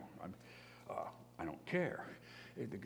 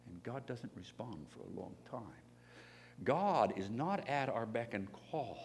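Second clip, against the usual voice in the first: ordinary delivery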